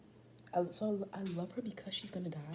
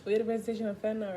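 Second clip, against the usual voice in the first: faster and louder, bursting with glee; excited; 4 semitones higher, 8 dB louder, faster than usual. Real, not acted. Vlogger to her camera, suddenly loud and giddy. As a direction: blurt it out excitedly because she can't hold it in. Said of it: "I should not be excited about that".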